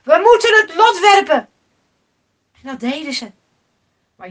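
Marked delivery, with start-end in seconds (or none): 0.0-1.5 loud, high urgent proposal; calling out. A narrator's reading. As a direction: shout it to your shipmates. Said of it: "riep een van de mannen". (luid roepen naar de bemanning)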